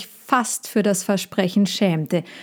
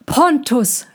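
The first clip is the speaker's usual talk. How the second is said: called out; 6 semitones higher, 3 dB louder than usual. A narrator's reading. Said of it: "rief er".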